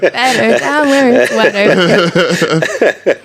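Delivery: slow, hammed-up Jamaican accent sung; badly faked Jamaican accent, crooning. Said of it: "Fucking really bad Jamaican accent".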